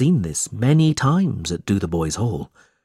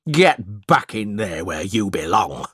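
lightly voiced cruel schoolmaster shouting; a hint of a harsh headmaster; mild shout. A narrator's reading. Squeers pushes Smike back inside, mild shout. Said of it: "shouted Mister Squeers".

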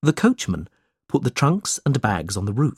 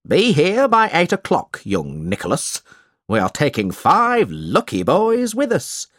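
higher, emphatic storybook schoolmaster; bossy schoolmaster laying down arrangements; 4 semitones higher than usual. Acted. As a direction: give orders as a self-important schoolmaster.

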